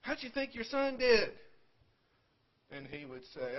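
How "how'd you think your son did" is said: high, loud calling to Mister Slaughter. Retold or imitated. People at the game calling to grandfather, loud and high. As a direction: call across the ballfield, much higher and louder.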